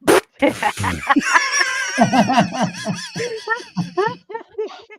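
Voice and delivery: long high burst of real laughter. Real, not acted. Guest laughing hard at his friend's joke. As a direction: crack up at the bad hunter joke.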